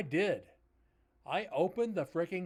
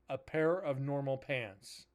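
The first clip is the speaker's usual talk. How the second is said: "A pair of normal pants" slowly, words separated; slow down and split "pair of normal" apart; deliberate, spaced-out "pair of normal" to explain the pun; pun spelled out. Shown as a demonstration.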